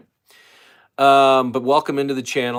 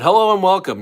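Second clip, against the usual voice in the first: excited greeting; 9 semitones higher, 11 dB louder than usual. Real, not acted. Host greets live stream viewers.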